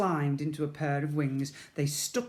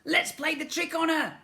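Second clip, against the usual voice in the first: high, loud cheeky voice; mischievous Slime; 14 semitones higher, 5 dB louder than usual. A narrator's reading. Slime plotting a trick on the sister, high. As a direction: plot it gleefully.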